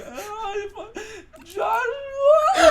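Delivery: high, loud, mock hysterical crying; hysterical sobbing. Bloodied kid tells dorm parent who did it, in mock hysterical sobs. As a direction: wail the name through sobs.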